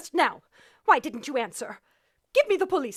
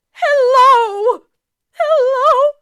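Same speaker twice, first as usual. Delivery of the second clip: frantic high cries into the phone; panicked cry; 6 semitones higher, 9 dB louder, much slower than usual. Acted. Terrified woman crying hello into a dead phone. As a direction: cry out in fear, high and loud.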